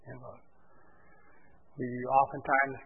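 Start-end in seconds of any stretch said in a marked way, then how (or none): 0.1-0.4 barely voiced, much softer; soft near-whisper. Shown as a demonstration. Pastor repeating the key words to the congregation, hushed. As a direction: let the repeat fall to almost nothing.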